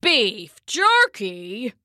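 "Beef jerky" very slowly, rising in disbelief; incredulous Papa. A narrator's reading. Papa hears what Mama put in the soup, incredulous. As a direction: drag it out as a questioning old man.

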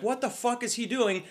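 high-pitched, baffled exclamation; incredulous, high-voiced reaction; alarmed disbelief. Recalled exclamation at swerving car.

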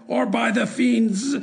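high, furious threat; a tyrant bellowing a curse; raging shout. A narrator's reading. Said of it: "shouted the monster".

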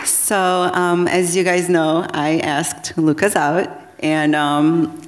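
ordinary talk, nothing marked

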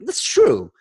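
high, bright "that's true" of sudden agreement; mock realization. Telling a friend, mock realization. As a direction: act out the moment it clicked. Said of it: "I was like".

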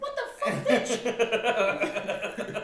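high, loud disbelief through laughter; laughing mock yell. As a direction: shriek it half laughing. Retold reaction to a question.

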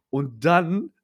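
normal talking voice

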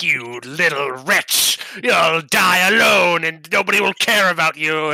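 cartoon supervillain voice, slow then loud; megalomaniac rant. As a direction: sneer it like a comic-book villain. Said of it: "a cartoon supervillain speech".

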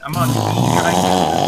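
put-on announcer voice. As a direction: announce it like a TV host. Repeats line in put-on voice.

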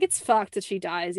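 ordinary delivery

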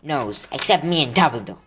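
loud, high start, settling down; proud. A narrator's reading. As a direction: boast it as Hagrid, chest out. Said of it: "said Hagrid prou-, proudly".

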